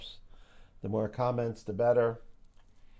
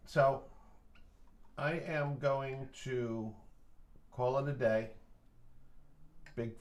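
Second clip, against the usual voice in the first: ordinary talk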